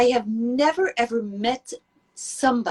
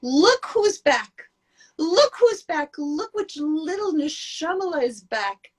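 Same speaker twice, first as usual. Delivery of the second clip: joyful angels; 6 semitones higher, slower than usual. Retold or imitated. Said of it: "they're going to say".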